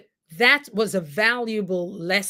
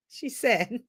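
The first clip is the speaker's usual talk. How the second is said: laughing; 3 semitones higher, 5 dB quieter than usual. Real, not acted. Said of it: "That's why I'm laughing".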